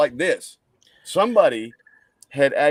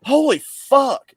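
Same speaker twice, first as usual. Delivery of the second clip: loud, much higher, slow shocked cry; shocked exclamation; 14 semitones higher, 9 dB louder, much slower than usual. Retold or imitated. Miss Betty seeing the axe wound, loud and shocked. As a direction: blurt it out in horror at the wound. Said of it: "Miss Betty walks over there and says".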